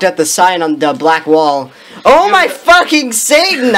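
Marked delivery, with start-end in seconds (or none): 2.0-3.8 mock scream. A narrator's reading. Narrator reads a character's shock at a sign, mock scream. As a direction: read the outburst high and theatrical. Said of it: "I screamed as I read it".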